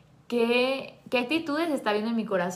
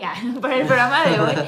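bursts out laughing mid-correction, louder; laughing at own slip; 9 dB louder, faster than usual. Real, not acted. Cohost laughing as she fixes her slip, loud laughter.

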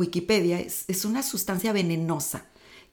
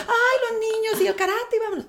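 mock perfect supermom; 5 dB louder than usual. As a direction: chirp it like a flawless happy mother. Mimicking her cheerful perfect sister.

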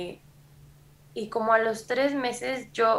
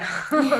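real laugh; 6 semitones higher, 5 dB louder than usual. Real, not acted. Laughing at herself crying.

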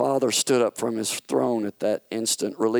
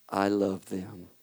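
softer, slow and low; deep and soft; 2 semitones lower, 5 dB quieter than usual. Acted. The Father answering Satan's why, deep and soft. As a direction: say it gently, from the heart. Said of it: "The Father's voice was deep and soft".

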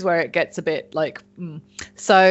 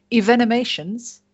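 slightly posh, raised pitch on the word; posh accent; 5 semitones higher than usual. Real, not acted. Podcaster relishing a new word, accidentally posh accent. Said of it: "I went really posh when I said that".

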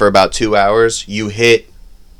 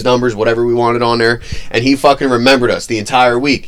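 ordinary delivery

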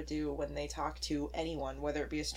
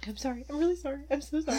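mock gushing apology, pitch climbing; lightly acted apologetic fretting; over-apologizing; 6 semitones higher, faster than usual. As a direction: pile on the apologies, higher each time. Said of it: "maybe just over-apologizing".

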